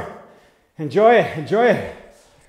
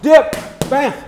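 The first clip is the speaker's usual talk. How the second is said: higher, loud rhythmic combo call; shouted command; 5 semitones higher, 6 dB louder than usual. Real, not acted. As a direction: bark out the combo. Coach calling out combos.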